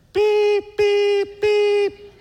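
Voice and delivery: high, loud electronic beeps; imitated machine beep. Imitating the monitor that keeps him awake. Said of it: "this noise happens every fifteen seconds".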